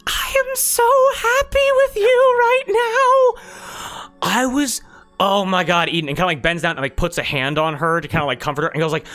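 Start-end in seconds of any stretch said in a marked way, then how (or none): 0.1-6.1 much higher, slow, louder gush of joy; gushing Slash Claw. Acted. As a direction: squeal it like an overjoyed fan. Slash Claw thrilled with Eden.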